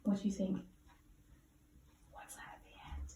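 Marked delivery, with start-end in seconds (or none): 2.1-3.1 whisper. Real, not acted. Teacher whispers a question to child.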